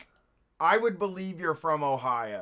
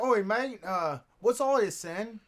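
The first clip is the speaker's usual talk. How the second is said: mock British accent; 4 semitones higher than usual. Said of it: "adopting an accent".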